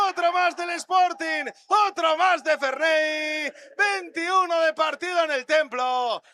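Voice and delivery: loud, high, drawn-out goal call; breakneck goal commentary. Real, not acted. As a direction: belt out the goal like a radio commentator.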